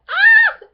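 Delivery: a real high, loud fan squeal; loud happy scream; excited squeal. Real, not acted. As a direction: shriek with delight at the pairing. Fangirling over favourite characters.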